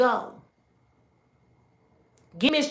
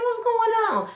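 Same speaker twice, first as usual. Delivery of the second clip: put-on child voice; 13 semitones higher than usual. Child asking mother.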